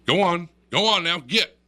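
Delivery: re-enacted shout to scram; shooing a bear. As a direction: drive it off like a dog. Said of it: "Tell him again".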